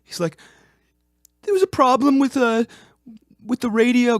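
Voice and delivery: put-on very high complaining old man; squeaky grumbling complaint; whiny high voice. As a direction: whine it up high like a put-upon employee. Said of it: "This is actually how he sounded".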